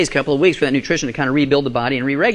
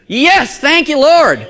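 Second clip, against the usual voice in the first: much higher and louder, triumphant cry; jubilant praise; 9 semitones higher, 5 dB louder, much slower than usual. Real, not acted. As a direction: celebrate it like a hallelujah.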